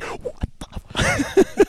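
high, slow, disbelieving what-the; stunned outburst. Retold or imitated. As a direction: imitate a friend who can't believe the film. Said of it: "He's losing his mind".

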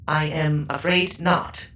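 polite, slow robot voice. Acted. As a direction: answer like a courteous service robot. Chef bot speaks.

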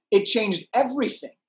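ordinary talk

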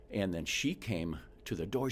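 ordinary delivery

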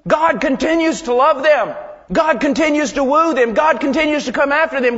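high, loud pulpit exhortation; forceful preaching. Real, not acted. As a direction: hammer each point home at full force.

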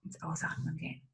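whispered, fading out; shrinking smaller and smaller. Shown as a demonstration. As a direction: breathe the words out softly. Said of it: "an meiner Stimme merkst du jetzt schon".

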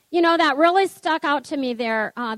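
ordinary delivery

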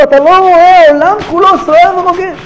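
high, pleading call to wake up; urgent ship's captain. Acted. Captain shaking Jonah awake on the stormy ship, high urgent plea. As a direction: rouse the sleeping prophet, high and insistent. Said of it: "מגיע אליו ואומר לו".

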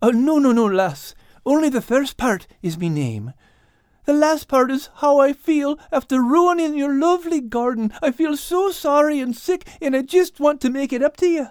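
very high, pleading Irish tomcat. Acted. Al sorry for the ruined garden, high pleading Irish voice. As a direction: apologise in a high Irish voice, rushing at the end.

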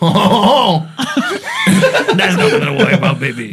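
lightly voiced sleazy cook; mock smooth reassurance. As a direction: croon it like a shady charmer. [name] reassuring customer.

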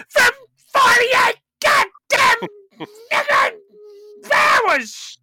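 high, slow comic yell; shouting. Pretend. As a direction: bellow it out with mock fury. Comic outburst closing the show.

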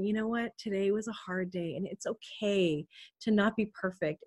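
quiet inner voice saying "Today was a hard day"; to yourself. Shown as a demonstration. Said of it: "you're not saying this out loud".

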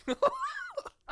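high shrieking laugh of disgust. Real, not acted. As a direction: laugh out in shock. Reacts to banana peel crunch.

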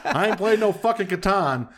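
laughing through a mock-defiant rant; 웃으면서 반항하듯 말하기. Real, not acted.

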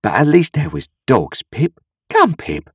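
high-pitched, coaxing plea; persuasive. A narrator's reading. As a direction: coax him to admit just a little. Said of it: "said Joe persuasively".

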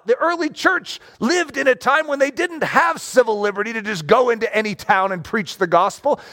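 normal talking voice

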